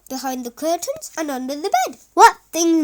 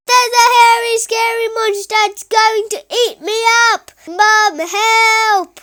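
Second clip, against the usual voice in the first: high, loud, fast pretend panic; yelled; 9 semitones higher, 10 dB louder than usual. Boy tricking mum, yelling in mock fright. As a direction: yell it like he's about to be eaten. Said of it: "yelled Kipper".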